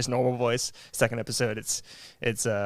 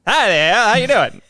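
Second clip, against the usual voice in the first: much higher and louder radio announcer greeting; old-timey radio voice; 9 semitones higher, 8 dB louder than usual. Pretend. Host imagining everyone greeting each other in old radio voice, much higher and louder. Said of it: "that's a fun voice to do".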